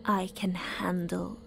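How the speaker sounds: a cult leader's icy whispered order; cold whisper. Acted. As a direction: quietly dismiss her, holding the anger in. Leader dismisses sister, faces Doctor.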